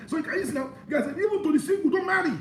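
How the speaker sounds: high-pitched, insistent sermon push; fiery preaching. Acted. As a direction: hammer the question at the congregation. Preacher urging singles not to marry.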